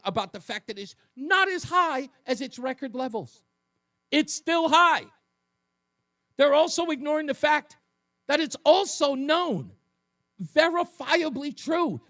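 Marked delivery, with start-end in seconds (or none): 1.2-12.0 louder, higher heated push; heated rant. Real, not acted. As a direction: ramp up the outrage. Ranting host.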